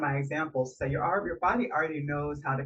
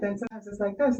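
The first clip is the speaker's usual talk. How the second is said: higher heart-centred voice; heart-only voice; 5 semitones higher, faster than usual. Shown as a demonstration. Vocal coach showing a heart-only voice, higher. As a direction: lift the voice up out of the belly. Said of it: "nobody takes it seriously".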